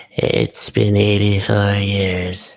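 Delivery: put-on slow, drawn-out old Rose line; old Rose quote. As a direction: stretch it out like an old woman remembering. Quoting old Rose, Titanic.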